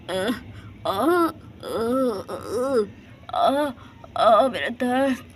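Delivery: broken gasping moans of pain; choking groans.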